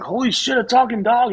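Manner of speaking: high, loud amazed cry; mock astonished yell at a talking dog; amazed shout. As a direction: blurt it out in total amazement. Joke: amazed customer.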